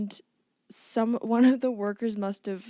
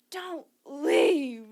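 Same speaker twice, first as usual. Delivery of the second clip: raised, higher mock yell of a stranded worker; half-hearted imitation of someone yelling into an intercom; mock intercom scream; 4 semitones higher than usual. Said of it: "screaming into it".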